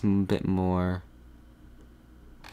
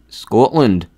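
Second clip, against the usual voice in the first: louder second go at a Scottish "Scotland"; Scottish accent; 7 dB louder than usual. Shown as a demonstration. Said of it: "I'll try again".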